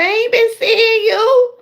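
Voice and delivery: high, drawn-out mock protest; indignant refusal. Streamer refusing an absent friend's request, retold indignantly. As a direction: push it up high, slow and pointed. Retells her pointed refusal to acquaintance.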